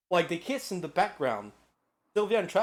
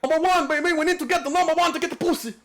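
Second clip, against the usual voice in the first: hyped shout; 12 semitones higher, 8 dB louder, faster than usual. Real, not acted. Hyped joke to chat.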